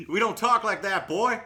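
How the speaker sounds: loud, high hammed-up American twang. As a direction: holler in a mock down-home American twang. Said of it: "American twang or whatever you got".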